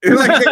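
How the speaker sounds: high, loud cackling laugh; loud burst of laughter. Real, not acted. Cracking up over the scammer's reaction, loud laughter. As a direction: break into a big real laugh.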